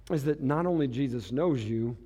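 ordinary delivery

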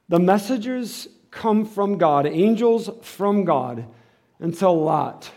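loud, slow, high preaching; emphatic preaching. Acted.

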